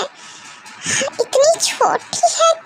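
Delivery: high, tearful worry; worried mother. Acted. Mother frets over her drunk child, tearful and high. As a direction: near tears over her little girl.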